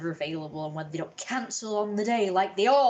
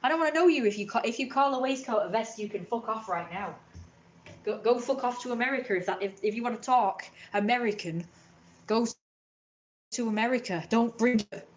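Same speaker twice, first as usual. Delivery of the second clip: mock angry rant; 3 semitones higher than usual. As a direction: scold them like an offended purist. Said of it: "And I'm like".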